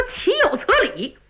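put-on a hot-headed stable boy venting; indignant servant. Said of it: "张宝说".

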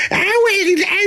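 put-on very high, shrill-pitched toddler giggle after crying; eerie child laugh. Scared child suddenly laughs at the threat, high eerie giggle. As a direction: flip from whimper to creepy laughter. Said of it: "一会儿哭一会儿笑的".

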